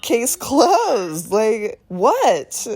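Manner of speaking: ordinary talk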